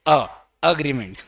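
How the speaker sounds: एग्रीमेंट with a short 'uh' start, slowly; model pronunciation. Shown as a demonstration. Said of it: "अ बोलेंगे". (एग्रीमेंट को 'अ' से शुरू करना)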